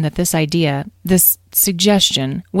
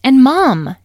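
higher, louder cut-in; eager interjection; 9 semitones higher, 10 dB louder than usual. A narrator's reading. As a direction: break in to win Mom over. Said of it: "interjected Petra".

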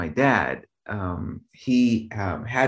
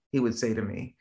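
normal talking voice

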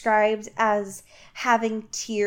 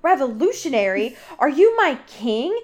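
pissed off; 4 semitones higher, 3 dB louder than usual. Retold or imitated. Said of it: "Garrett gets, like, even more pissed".

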